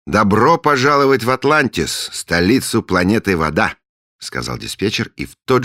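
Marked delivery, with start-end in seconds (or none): 0.1-3.8 high, loud ceremonial greeting; grand welcome. Acted. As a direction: announce it like a herald.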